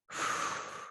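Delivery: long breathy whoosh out through the mouth; audible whoosh exhale. Shown as a demonstration. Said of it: "sounds like a whoosh".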